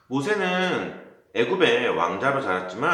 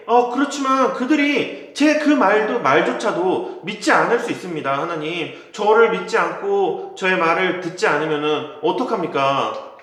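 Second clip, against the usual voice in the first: higher, louder, worried pleading; anxious protest; 6 semitones higher, 5 dB louder than usual. Acted. Moses nervously protesting to God, higher and pleading. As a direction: plead that you are not worthy.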